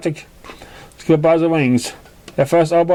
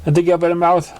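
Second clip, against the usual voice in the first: plain reading voice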